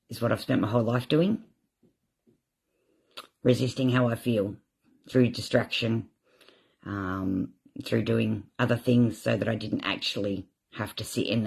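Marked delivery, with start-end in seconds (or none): none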